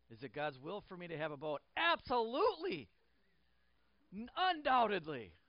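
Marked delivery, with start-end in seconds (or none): 1.8-5.3 mock eager yes. Jokes he deserves a boat.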